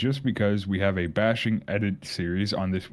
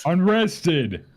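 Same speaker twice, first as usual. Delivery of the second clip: calling out; 8 semitones higher, 3 dB louder than usual. Real, not acted. Calling out to a friend.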